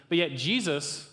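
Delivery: normal talking voice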